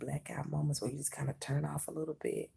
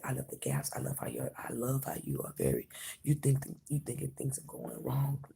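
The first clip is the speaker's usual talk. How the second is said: usual speaking voice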